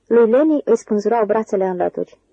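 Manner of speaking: usual speaking voice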